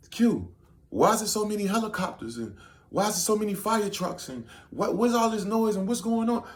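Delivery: put-on high whiny girl voice, slowed on trucks; mock girlfriend voice. Imitating the girl in the car asking about helicopters, high and whiny. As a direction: imitate her in a high fussy voice.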